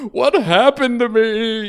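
loud, high, drawn-out wail; hammed-up bawling question, dragged out slowly; mock sobbing. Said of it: "shaking and sobbing".

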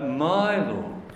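"my" stretched and lifted, "Lord" falling away; stress on my. Retold or imitated. Preacher contrasting personal faith with common knowledge, stressing "my". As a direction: make "my" the weighty word, personal and deliberate. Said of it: "that little word "my" was very important".

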